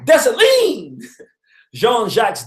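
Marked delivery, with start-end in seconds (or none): 0.0-1.1 slow, high, loud call of the name; loud shout after a pause. Retold or imitated. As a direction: hold back, then shout it proudly. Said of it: "She, she paused".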